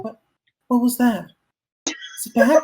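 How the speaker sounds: put-on high-pitched, alarmed girl; girl asking in a high, anxious voice; frightened little girl. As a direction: play a startled child, voice lifted high. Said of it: "the girl was like".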